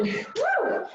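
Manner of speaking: high whooping cheer. Real, not acted. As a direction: whoop to fire up the class. Cheering class on at interval end.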